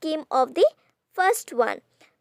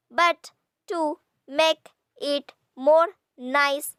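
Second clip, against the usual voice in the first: "But to make it more nice" slow, a pause after each word; syllable by syllable; slower than usual. Shown as a demonstration. Teacher counting syllables, "But to make it more nice" slowly separated. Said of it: "here we have six syllables".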